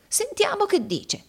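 very high, thin frightened voice; terrified. A narrator's reading. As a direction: plead nervously in a high pitch. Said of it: "esclamò Gride atterrito".

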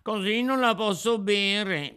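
high, loud whine of refusal; grimacing puppet child. Acted. Puppet to the Fairy over the glass, whining refusal. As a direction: whine it high, pulling faces. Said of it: "facendo mille smorfie".

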